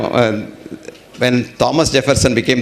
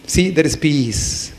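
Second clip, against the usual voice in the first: calm reveal; 2 semitones higher, slower than usual. Shown as a demonstration. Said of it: "guru revealing peace".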